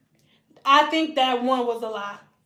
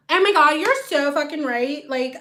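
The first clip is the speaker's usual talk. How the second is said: ordinary talk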